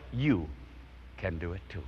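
usual speaking voice

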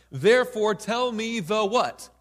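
loud, slow royal demand with each word stretched; the king bellows for the dream, slowly. Acted. Preacher voicing the king, demanding the dream, loud and slow.

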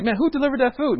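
high, loud, excited asking; excited question. Retold or imitated. Neighbor at the shelter asks who delivered, excited. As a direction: mimic a curious neighbor, lit up.

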